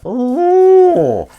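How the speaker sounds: long, loud, high ooh; hammed-up drawn-out whoop; excited ooh. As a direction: let out a big swooping ooh. Reacts to being told of role.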